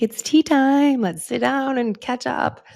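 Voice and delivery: hammed-up eager husband calling tea time; excited husband. Imitating J P, thrilled at the tea pouring, hammed-up high voice. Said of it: "He's like".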